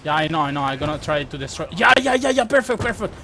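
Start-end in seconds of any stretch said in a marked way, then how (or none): 1.8-3.1 excited. Real, not acted. Excited in firefight.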